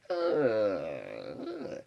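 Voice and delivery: low, drawn-out mock "ugh"; long disgusted groan. Classmate reacting to her announcement, low mock groan. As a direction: groan quietly in exaggerated disgust.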